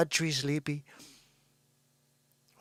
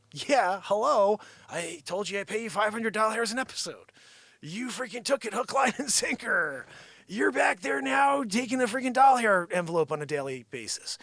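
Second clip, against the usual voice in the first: raised, louder mock-outraged voice; exasperated retort; 5 semitones higher, 5 dB louder than usual. Host answering Joanne's pay complaint, mock exasperation. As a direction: push it higher, arguing your case.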